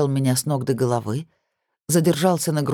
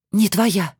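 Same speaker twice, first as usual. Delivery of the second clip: higher, defiant retort through the teeth; hissed through teeth; 4 semitones higher than usual. A narrator's reading. Woman denying she is his, hissed through the teeth. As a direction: push it out through clenched teeth. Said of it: "прошипела сквозь зубы".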